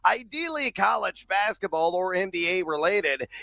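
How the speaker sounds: normal talking voice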